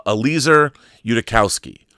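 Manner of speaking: ordinary talk, nothing marked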